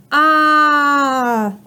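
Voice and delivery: "Ah" held long and steady; teacher drawing out the sound of "Ah". Shown as a demonstration. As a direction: stretch "Ah" out as one sustained vowel. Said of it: "What letter says ah".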